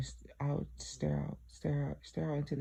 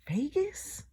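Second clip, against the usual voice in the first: much higher, rising in total disbelief; shocked disbelief; 9 semitones higher than usual. Retold or imitated. Questioning Jesus about Vegas with high, shocked disbelief. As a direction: ask again, more stunned.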